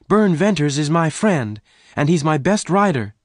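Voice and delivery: high-pitched protesting woman; crying out. A narrator's reading. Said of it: "Jane cried".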